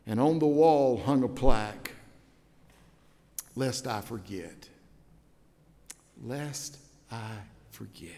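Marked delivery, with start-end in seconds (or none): 3.5-8.1 hushed solemn. Acted. Solemn repeated plaque motto.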